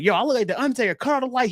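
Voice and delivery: high, playful mock boast; playful imitation. Player in the cryo chamber, mock boasting high and playful. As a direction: pitch it up, teasing and showy. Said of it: "being like".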